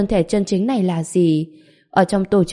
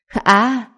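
agonized scream of a man burning; 4 semitones higher than usual. Acted. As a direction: cry out as flames engulf you. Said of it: "Từng tiếng la hét thảm thiết".

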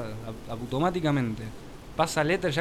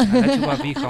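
joking shout; 9 semitones higher, 7 dB louder than usual. Pretend. Playful insult shouted at cohost.

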